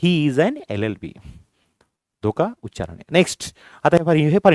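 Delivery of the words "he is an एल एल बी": slow, spaced out reading; slow teacher's demo; slow model sentence. Shown as a demonstration. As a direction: model it slowly for the class. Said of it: "अ, अ".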